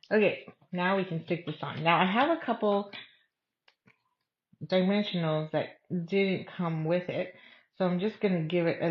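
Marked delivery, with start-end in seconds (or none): none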